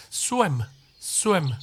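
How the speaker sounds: "Swim" as one syllable with a rounded w, "swem"; short falling "Swim", lips pushed out for the w; w-sound pronunciation. Shown as a demonstration. Teacher models "Swim" with a proper w. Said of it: "スイムじゃないですよ".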